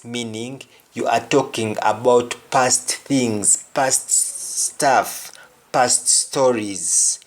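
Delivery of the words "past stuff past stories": usual speaking voice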